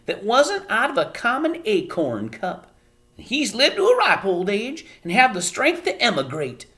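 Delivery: high, fussy little mother; a worried tiny mother lecturing her daughter; Homily voice. A narrator's reading. Homily voiced while reading aloud.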